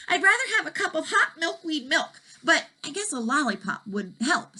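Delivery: high little dragon voice; lightly voiced dragon.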